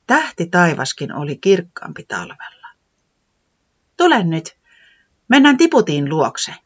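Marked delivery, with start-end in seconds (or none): 4.0-6.5 higher, louder urging voice; hurrying weatherman. Acted. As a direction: hurry her along. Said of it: "säämies hoputti".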